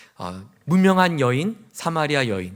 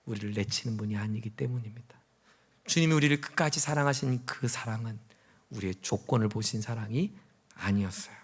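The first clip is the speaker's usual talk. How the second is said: low, very soft sermon voice; quiet, intimate reassurance; hushed preaching; 4 semitones lower, 7 dB quieter than usual. Real, not acted. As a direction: speak tenderly, with pauses between phrases.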